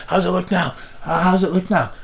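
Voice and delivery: high-pitched calls, one echoing the other; mock calling out. Father asks and mother relays it, mild mock yell. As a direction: imitate parents relaying a shouted question.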